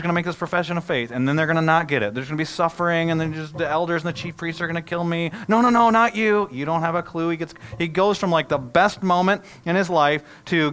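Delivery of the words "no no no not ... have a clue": loud protest. Retold or imitated. Peter rebuking Jesus, voiced.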